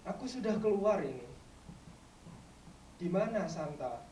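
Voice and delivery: slow, drawn-out lines with long pauses; poetry recitation. Acted. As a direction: recite it as a plea to Mama. Recites poem as child to mother.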